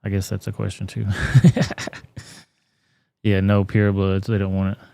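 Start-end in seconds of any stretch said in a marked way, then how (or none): 1.1-2.2 laugh. Real, not acted. Laughs at the upspeak demo.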